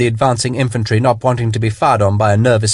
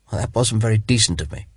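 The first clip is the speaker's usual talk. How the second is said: softer, hushed to the stars; low voice; 5 dB quieter, faster than usual. A narrator's reading. Dying lord confessing guilt to Sharp, hushed. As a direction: murmur it almost to himself. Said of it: "His voice was low".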